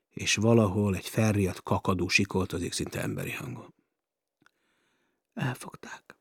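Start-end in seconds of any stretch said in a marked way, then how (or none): 5.3-6.2 faint breaking whisper; despairing hushed whisper; broken whisper. Acted. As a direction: whisper it as the voice cracks. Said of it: "suttogta töredező hangon".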